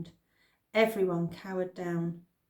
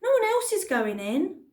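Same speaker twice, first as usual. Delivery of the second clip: loud; 11 semitones higher, 5 dB louder than usual. Acted. Cliff protesting, continuing.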